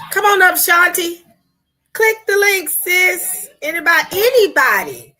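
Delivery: high, loud invitation to join the live; calling out. Real, not acted. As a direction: call out to everyone in the chat.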